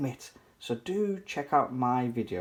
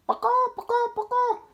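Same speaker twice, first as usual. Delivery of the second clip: much higher clucking; chicken clucks; 3 dB louder, faster than usual. Acted. Three chickens fight over one sofa, high clucking. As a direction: cluck like a hen.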